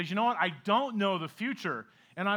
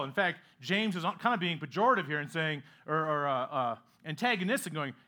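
usual speaking voice

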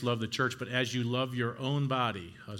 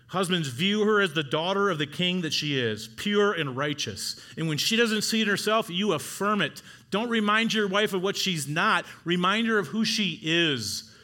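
higher, louder preaching to husbands; preaching at full force; 6 semitones higher, 4 dB louder than usual. Acted. Pastor exhorting husbands to affirm wives, full-force preaching. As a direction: lift them up with it.

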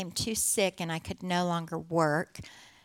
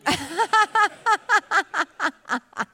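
loud, high burst of laughter; long, rapid run of ha-ha laughs; real laughter; 11 semitones higher, 8 dB louder than usual. Real, not acted. Laughs at her own memory slip.

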